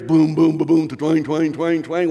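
mock booming bass and twanging guitar, loud on the booms; imitating a loud car stereo from three blocks away; imitated car bass. Preacher mocks a neighbour's blaring car music with booming bass sounds.

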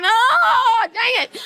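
high, wailed protest; hammed-up wail of regret; mock dismay. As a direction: cry out as if caught by your own prayer. Acts out dismayed reply to God.

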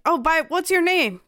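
ordinary talk

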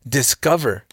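ordinary delivery

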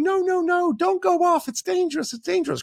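put-on nurturing parent calling after the runaway child, high and fretful. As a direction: call after a wandering child in a panic. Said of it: "Nurturing parents going".

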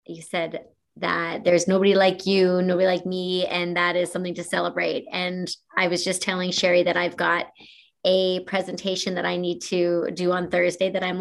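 normal talking voice

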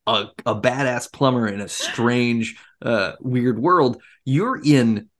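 usual speaking voice

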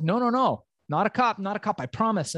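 pleading denial. Retold or imitated. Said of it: "And I was like".

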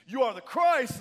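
high, loud, bold proclamation; ringing preacher's shout; unapologetic declaration. Acted. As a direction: declare it boldly at full voice. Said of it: "say without an apology".

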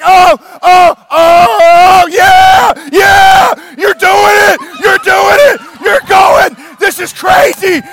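high, loud whooping shouts; wild cheering. Acted. Jesus cheering from the crowd, wild excited shouting. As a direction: yell like a fan going crazy in the stands. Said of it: "I see him up there like".

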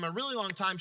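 ordinary talk

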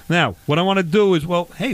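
ordinary talk, nothing marked

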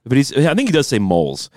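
ordinary talk

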